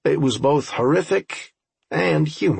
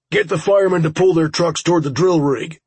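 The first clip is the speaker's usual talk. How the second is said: raised voice, higher in pitch; urgent radio order; 5 semitones higher, 4 dB louder, faster than usual. Acted. Radioed order during battle.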